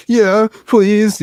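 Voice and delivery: put-on high, loud, childlike eagerness; a little boy jumping at the offer; squeaky excited child voice; eager kid. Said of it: "And I was like".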